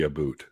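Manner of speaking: "about." with an oo-like vowel; Ontario about. Shown as a demonstration. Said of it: "they pronounce it closer to the".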